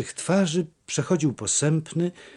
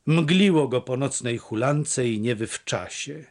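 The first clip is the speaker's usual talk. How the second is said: usual speaking voice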